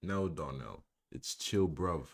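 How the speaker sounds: loud, hammed-up English accent. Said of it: "Am I saying it in an English accent".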